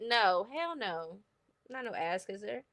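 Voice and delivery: ordinary talk, nothing marked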